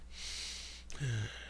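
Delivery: long breathy exasperated sigh; weary sigh. Acted. Pearl giving up on the two kids, a weary sigh. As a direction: let out a tired sigh. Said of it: "Pearl could only sigh".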